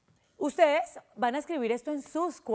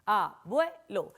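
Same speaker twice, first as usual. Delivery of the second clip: "Abuelo" in three slow detached beats; split into syllables; 2 semitones lower, much slower than usual. Shown as a demonstration. Teacher repeating for children, "Abuelo" split into syllables. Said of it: "Tiene tres sílabas".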